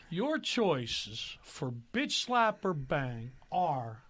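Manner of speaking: very slow, drawn-out game-show lead-in; slow quizmaster reveal; suspenseful host setup. Real, not acted. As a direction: stretch each word to build suspense. Host builds up to the choices.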